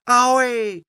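high, loud, long "ahoy"; ringing high-pitched bird's hail; calling out. Acted. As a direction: holler ahoy as loud as you can. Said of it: "riep Karel".

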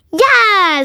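loud, high, drawn-out cheer; gleeful dragon shout. A narrator's reading. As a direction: yell it eagerly. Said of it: "schreeuwde Sam".

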